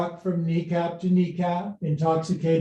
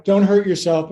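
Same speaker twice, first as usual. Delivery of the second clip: ordinary talk, nothing marked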